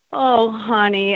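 slow, tender sympathy; consoling. Retold or imitated. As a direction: imitate a friend gently comforting. Friend consoles her as she cries.

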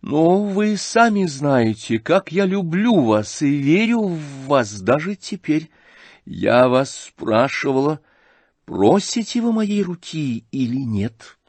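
высокий, медленный голос Аглаи; строго и медленно спрашивает жениха; Аглая допрашивает. Acted. Аглая допрашивает князя, голос героини. (высоким голосом, медленно, с нажимом)

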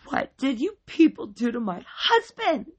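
high, loud distraught wail. A narrator's reading. Wife finds her husband unconscious, wailing. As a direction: wail it in hysterical alarm. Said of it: "she wailed".

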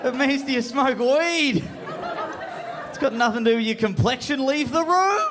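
high, strained comic telling-off; exasperated, rising to a high cry; exasperated inner scolding. Acted. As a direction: scold yourself in high-pitched disbelief. His brain keeps scolding him.

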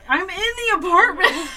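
high, loud, laughing protest; laughing exasperation. Retold or imitated. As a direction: laugh at a cat who won't stop calling. Exasperated answer to cat.

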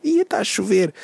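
high-pitched mock-amazed announcement; mock amazement. People writing weather posts on Facebook, mock amazement. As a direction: imitate someone posting it as big news. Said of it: "querem escrever tipo".

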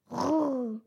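rolled tiger growl; trilled animal snarl. A narrator's reading. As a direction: imitate a tiger's growling hiss. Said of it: "fauchten die gestreiften Tiger".

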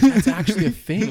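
high and very loud; a loud, high burst of delighted agreement; excited outburst. Retold or imitated. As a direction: blurt it out in delight. Laughing quote of his reaction.

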